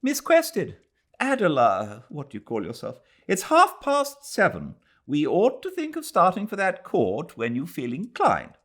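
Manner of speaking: high, imperious memsahib calling upstairs; Mrs Turton's voice. Acted. Mrs Turton hurrying the girl along to court, high and bossy.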